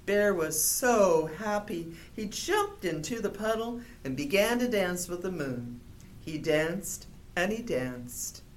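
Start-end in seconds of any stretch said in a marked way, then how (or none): 6.2-8.4 fading close. A narrator's reading. Story's closing refrain.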